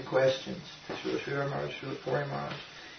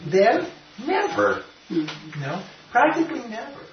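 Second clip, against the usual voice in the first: teasing never; 7 semitones higher than usual. Real, not acted. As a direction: stretch out never, grinning at the joke. Playful answer to his own maybe.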